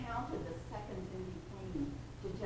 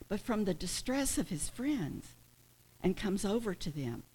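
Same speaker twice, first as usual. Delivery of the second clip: normal talking voice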